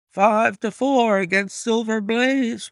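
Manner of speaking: high, loud betting-ring cry; roaring bookmakers. A narrator's reading. Bookmakers calling odds to the crowd, roaring. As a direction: call the odds out over a crowd. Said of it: "roared the ring".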